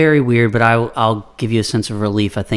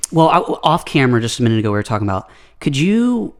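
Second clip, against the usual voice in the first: ordinary delivery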